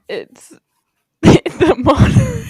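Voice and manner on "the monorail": blurted guess. Real, not acted.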